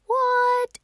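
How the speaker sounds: high, loud, indignant what; querying child. Acted. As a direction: protest with a question. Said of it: "Ada queried".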